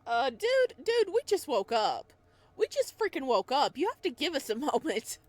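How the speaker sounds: loud, high, laughing complaint; exasperated protest. Real, not acted. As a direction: push back half laughing. Streamer protests at villain.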